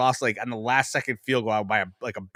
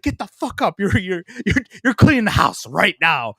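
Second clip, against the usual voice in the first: imitating a furious mother, pitched higher; angry mom; 7 semitones higher, 5 dB louder than usual. Mom waking her hungover son, angry and higher. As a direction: bark the orders like an angry mom. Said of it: "she's like".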